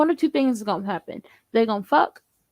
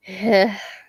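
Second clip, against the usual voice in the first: short exasperated sigh; weary sigh; 4 semitones lower than usual. Retold or imitated. Viewer reacting to a messy story, weary sigh.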